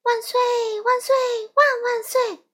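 put-on very high, gleeful child's hurrah; happy cheering. A narrator's reading. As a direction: cheer like a delighted little kid. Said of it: "阿文高兴地说".

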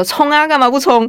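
high, bright urging to go for it; egging her on with a mock cheer; eager cheering. Friend urging her to chase the rich guy, excited cheer. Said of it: "我朋友就说".

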